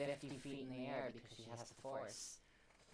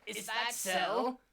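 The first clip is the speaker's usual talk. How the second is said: high, very loud cartoon squawk; mutant Goofy voice; 10 semitones higher, 19 dB louder, slower than usual. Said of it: "why do you sound like a fucking mutant Goofy".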